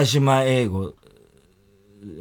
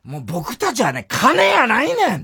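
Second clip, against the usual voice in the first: high-pitched protest in Osaka dialect; mock indignant retort; 8 semitones higher than usual. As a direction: act out an offended, high-voiced denial. Acting out singer's indignant retort. (大阪弁で憤慨したふり、高めの声)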